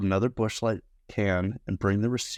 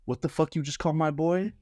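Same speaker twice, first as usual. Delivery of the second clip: tough guy challenge; 8 semitones higher than usual. Retold or imitated. Friend confronts bartender.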